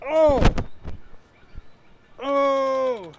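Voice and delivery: loud, high cry of pain; pained shout. Real, not acted. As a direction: yell out in real pain. Sharp pain reaction.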